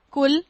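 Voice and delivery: کل as a loud kul with a plain k; mispronounced kul, said loudly; k for qaf. Shown as a demonstration. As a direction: punch out کل with a front k, not qaf. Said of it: "کل آپ کھائیے".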